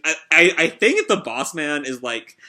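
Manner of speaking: ordinary talk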